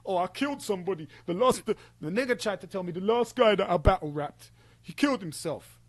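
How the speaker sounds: fast, put-on bragging tone; mock his boasting; mocking boastful voice. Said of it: "Do you know them ones".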